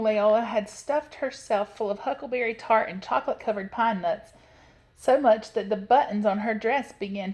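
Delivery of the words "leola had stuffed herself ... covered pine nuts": usual speaking voice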